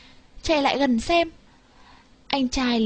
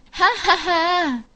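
slow, higher gloating cackle; drawn-out mocking ha ha ha; villain laugh; 7 semitones higher, slower than usual. A narrator's reading. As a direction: laugh like a smug scheming old man. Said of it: "hí hửng bảo nhau".

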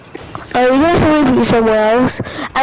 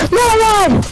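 high panicked no; panicking gamer; 10 semitones higher than usual. Real, not acted. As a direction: yelp it in panic. Teammate under fire, yelling.

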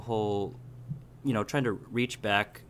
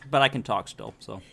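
talking with a mouthful of apple; chewing while talking; 3 semitones higher than usual. Real, not acted. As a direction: chew and talk at once. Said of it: "Excuse the chewing".

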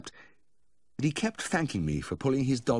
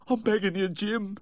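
high, weepy pleading; whimpering plea down the phone; tearful begging; 14 semitones higher than usual. A narrator's reading. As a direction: beg in a high wailing voice. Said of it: "he went on as though he hadn't heard".

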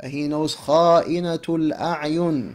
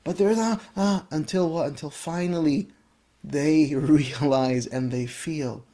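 ordinary talk, nothing marked

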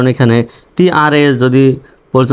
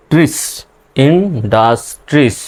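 Industries drawn out very slowly; slowly enunciated industries; slow demo; much slower than usual. Shown as a demonstration. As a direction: stretch industries syllable by syllable. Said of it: "এভাবে ভেঙে ভেঙে উচ্চারণ করবেন".

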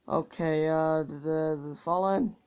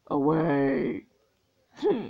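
usual speaking voice

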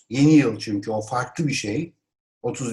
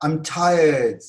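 loud, higher-pitched cry; exclamation; 4 semitones higher, 5 dB louder than usual. Shown as a demonstration. Teacher models complaining of tiredness, exclaimed. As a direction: exclaim it emphatically. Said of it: "bunu böyle haykırarak söylüyorsunuz".

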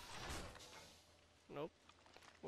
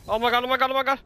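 panicked shout, much higher and louder; yelling while running away; 10 semitones higher, 17 dB louder than usual. Real, not acted. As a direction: scream it in real panic. Nearly falling while fleeing enemies.

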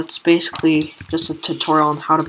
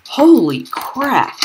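high, louder, drawn-out astonishment; amazed exclamation; 9 semitones higher, 5 dB louder, much slower than usual. Real, not acted. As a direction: exclaim slowly in disbelief. Amazed by own shot.

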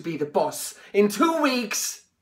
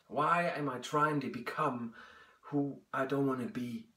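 softer, inward questioning; quiet; 5 semitones lower, 9 dB quieter than usual. Acted. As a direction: ask yourself in a hush. Self-questioning, hushed.

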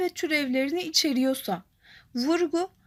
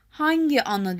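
teacher marks hangi; stress on hangi. Shown as a demonstration. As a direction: punch the word hangi. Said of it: "Hangi kelimesi vurgulu olan kelimemiz".

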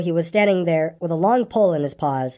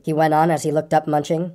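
ordinary delivery

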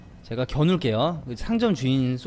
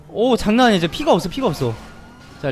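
ordinary talk

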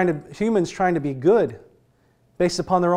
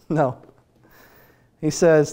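normal talking voice

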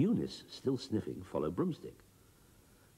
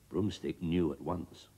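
ordinary delivery

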